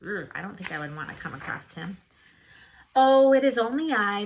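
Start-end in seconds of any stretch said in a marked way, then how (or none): 2.9-4.2 higher, louder goat voice; middle goat. Acted. Middle goat answering the troll, higher and louder. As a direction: answer brightly and innocently.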